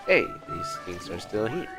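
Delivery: ordinary talk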